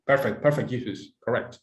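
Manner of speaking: ordinary delivery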